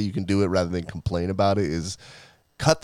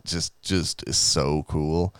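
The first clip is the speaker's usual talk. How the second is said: mock slow so cool; 2 semitones lower, much slower than usual. Drawn-out mock bragging.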